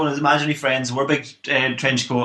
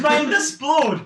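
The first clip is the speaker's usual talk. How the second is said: amazed; 11 semitones higher, much slower than usual. Acted. Kid's amazed reaction.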